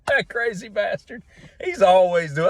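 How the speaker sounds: high, head-shaking amusement; fond exasperation. Retold or imitated. Barbershop guy fondly scolding Bob, high pitched. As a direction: tsk at him like a lovable idiot.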